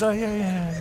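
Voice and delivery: forced smile. Retold or imitated. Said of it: "I gotta smile like this".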